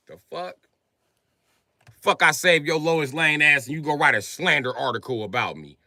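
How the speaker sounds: ordinary delivery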